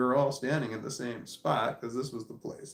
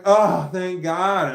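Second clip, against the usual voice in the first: loud, drawn-out relieved exclamation; huge relief; 3 semitones higher, 8 dB louder, much slower than usual. Retold or imitated. Tired man greeting roommate at last, relieved. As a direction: let it out with heavy relief.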